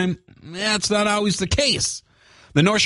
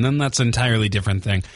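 ordinary talk, nothing marked

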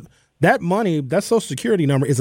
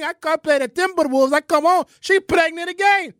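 mock Greek-accented broken English, higher pitched; Giannis impression; 4 semitones higher than usual. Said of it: "Giannis is not an American".

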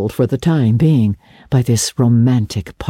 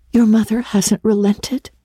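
put-on higher, girlish gasp of dismay; gasped question; 7 semitones higher than usual. A narrator's reading. Anne learns her friend's mother hasn't relented, dismayed gasp. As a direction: ask it higher, as a dismayed girl. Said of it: "she gasped".

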